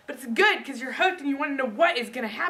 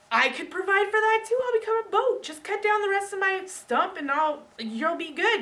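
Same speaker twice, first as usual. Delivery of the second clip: much higher and louder, bright eager offer; the tree offering to become a boat, eager and high; 10 semitones higher, 4 dB louder than usual. Retold or imitated. Eager tree voice, much higher and louder.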